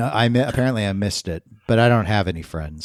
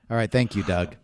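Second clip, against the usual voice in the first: usual speaking voice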